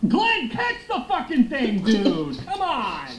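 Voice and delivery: high-pitched, drawn-out yell of frustration; exasperated yell. Real, not acted. Player yelling at Glenn to catch it, exasperated. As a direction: cry out at a blown play, pitch shooting up.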